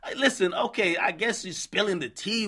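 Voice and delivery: ordinary delivery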